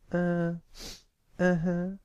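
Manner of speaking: put-on high, small-boy voice; tearful child. A narrator's reading. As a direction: answer in a high, teary little voice. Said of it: "Pipsqueak sniffed and let his built-up tears free".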